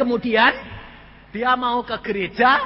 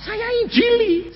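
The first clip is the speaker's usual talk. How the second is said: high-pitched retort from the husband; acted protest; 14 semitones higher than usual. Husband protesting to the pastor, high-pitched retort. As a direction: blurt it out, pitched way up.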